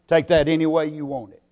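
normal talking voice

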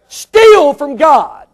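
"Steal from God" shouted slow and high. Shown as a demonstration. Preacher hammering the point to the congregation, shouted.

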